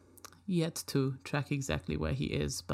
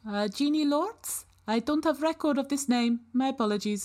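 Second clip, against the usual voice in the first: polite robot; 10 semitones higher than usual. Acted. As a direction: answer like a helpful but clueless robot. Robot answers search query.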